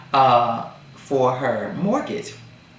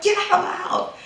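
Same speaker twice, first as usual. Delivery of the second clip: mock demand; faster than usual. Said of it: "she was like".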